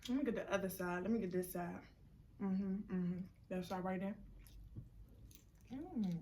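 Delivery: muffled, mouth full of ribs; chewing while talking; wolfing down food. Real, not acted. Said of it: "eating like I'm starving".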